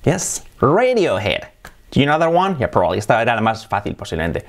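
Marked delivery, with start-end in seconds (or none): 0.0-1.4 slow "Radiohead" as REY-dio-hed; slow English model of Radiohead; English pronunciation. Shown as a demonstration. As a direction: draw out RAY-dio-head in English. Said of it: "el nombre sería Radiohead".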